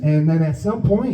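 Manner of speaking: ordinary talk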